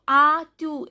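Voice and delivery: "as-tu" with an open a, then tu; silent S. Shown as a demonstration. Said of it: "S is not pronounced".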